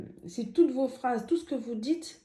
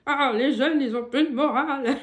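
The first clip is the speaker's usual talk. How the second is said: put-on louder, laughing grumpy old man; 3 semitones higher, 6 dB louder than usual. Shown as a demonstration. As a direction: play a grumbling old man, half laughing. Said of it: "je leur fais avec une voix de vieux".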